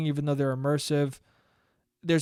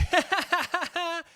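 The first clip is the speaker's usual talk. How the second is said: mock brother laugh; 12 semitones higher, 3 dB quieter than usual. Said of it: "my brother was just like".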